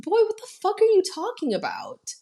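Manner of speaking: high, sassy disbelief; high incredulous sister voice; incredulous Catherine. Retold or imitated. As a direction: demand an answer, high and outraged. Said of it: "Catherine's like".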